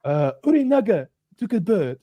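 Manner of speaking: put-on high, hesitant Japanese-accented reply; timid Japanese-accented answer; mock Japanese accent. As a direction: imitate halting Japanese-accented English. Imitated servant answering the Englishman.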